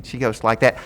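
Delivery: ordinary talk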